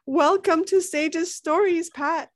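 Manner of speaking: high, loud, slow show greeting; excited announcer welcome. Real, not acted.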